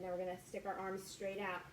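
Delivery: ordinary talk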